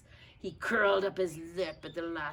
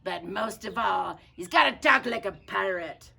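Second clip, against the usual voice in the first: pirate refrain; 7 dB louder than usual. Acted. Captain lists pirate duties.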